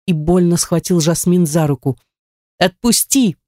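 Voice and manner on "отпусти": higher-pitched demand to let go; alarmed cry. Acted. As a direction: cry out to be released, volume held back. Grabbed woman demands release.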